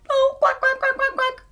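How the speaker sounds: high, worried duck quacks; high-pitched pretend duck distress; mama duck calling. Mama duck calling her lost ducklings.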